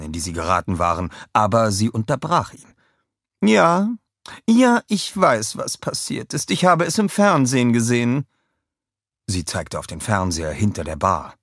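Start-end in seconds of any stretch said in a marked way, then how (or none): 3.4-8.3 high, impatient queen voice; old queen. A narrator's reading. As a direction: cut him off wearily. Queen interrupts Kevin. (hohe, ungeduldige Königinnenstimme)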